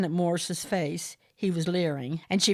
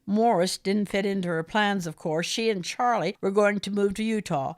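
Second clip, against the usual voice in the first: usual speaking voice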